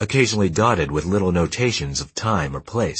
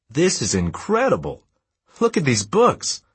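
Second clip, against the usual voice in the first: higher-pitched, thrilled call across a room; excited calling out; 9 semitones higher than usual. A narrator's reading. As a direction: call out your amazing find from afar. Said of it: "said from across the dusty basement".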